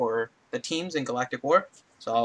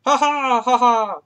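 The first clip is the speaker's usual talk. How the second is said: higher puppet frog chuckle; Kermit laugh; 10 semitones higher, 6 dB louder, slower than usual. Acted. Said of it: "my best Kermit the Frog impression".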